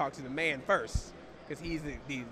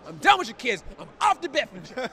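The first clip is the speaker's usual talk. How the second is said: loud, high-pitched mock outburst; acted exasperated shout; fed-up parent; 8 semitones higher, 9 dB louder than usual. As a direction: blow up at the kids, playfully over the top. Acted parent snapping at the kids.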